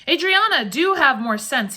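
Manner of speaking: loud, high call, then slowing reproof; exasperated cry. A narrator's reading. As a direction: cry out to bring her to her senses. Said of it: "he cried".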